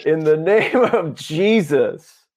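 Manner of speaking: slow, high, drawn-out proclamation; booming healing command. Retold or imitated. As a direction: declare it grandly, stretching the words.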